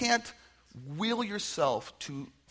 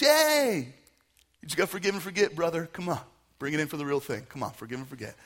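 mock cheery advice; 5 dB louder, faster than usual. Preacher parodying a glib friend's advice, hammed-up cheerful and loud. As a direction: parody the glib friend pushing a quick fix.

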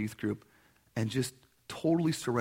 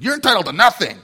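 louder, higher, harsh "You're entitled to nothing" stressing entitled; threatening mock; 8 semitones higher, 12 dB louder, faster than usual. Shown as a demonstration. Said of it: "That would be a threat".

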